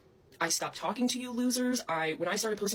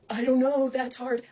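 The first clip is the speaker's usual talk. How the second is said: louder, higher mock of dithering critics; 3 semitones higher, 6 dB louder, slower than usual. Mimicking critics who dodge the question, higher and louder. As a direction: ham up their indecision, mockingly. Mocking her critics' reply.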